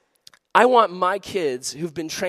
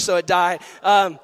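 ordinary delivery

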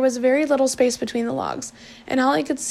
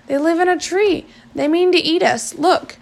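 put-on higher, louder frightened boy; panicked; 5 semitones higher, 5 dB louder than usual. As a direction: blurt it out in panic.

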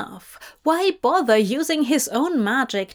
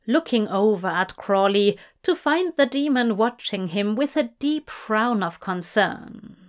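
plain reading voice

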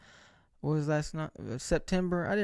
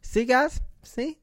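much higher, triumphant I-told-you-so; 11 semitones higher, 6 dB louder, slower than usual. Retold or imitated. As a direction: crow that he was right. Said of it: "He's like".

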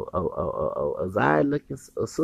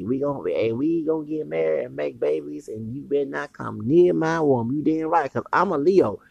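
normal talking voice

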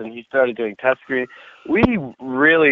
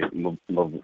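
ordinary talk

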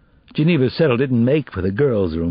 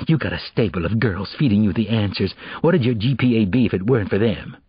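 plain reading voice

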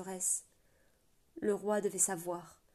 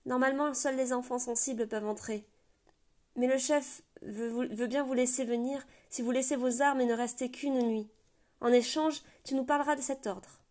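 put-on higher, louder little girl; girl laying down the chief's terms; child's voice; 4 semitones higher, 6 dB louder than usual. A narrator's reading. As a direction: speak like a young oracle girl. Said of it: "la fillette ne parle".

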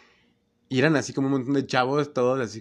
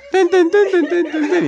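much higher, loud sung dramatic violin stabs; imitating dramatic violins; 11 dB louder, slower than usual. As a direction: mimic the soap opera's dramatic violins, high and loud. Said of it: "Y así los violines". (imitando violines dramáticos, muy agudo y fuerte)

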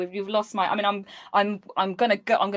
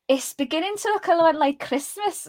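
high-pitched, shaky attempt at Scouse; put-on Scouse line reading; attempted Scouse accent; 6 semitones higher than usual. Shown as a demonstration. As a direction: have a go at a heavy Liverpool accent. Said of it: "Scouse accent".

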